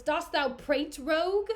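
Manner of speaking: loud and angry; angry shout. Acted. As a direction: snarl it while striking. Cassio hits Rodrigo.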